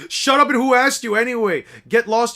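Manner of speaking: acted irritated yell; angry retort. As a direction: shut the other person down sharply. Character snaps at a heckler.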